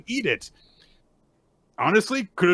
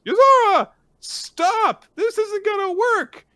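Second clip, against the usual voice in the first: much higher, louder panicked yell; squeaky yell; 8 dB louder than usual. Retold or imitated. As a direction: shriek for her to stop. Gakuro yelling at Yozora.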